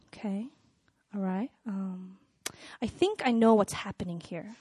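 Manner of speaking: very soft, low, halting reply; quiet guy. Retold or imitated. Woman imitates her quiet boyfriend answering, very quiet. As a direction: barely speak up, pausing between small phrases. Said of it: "he's a very quiet guy".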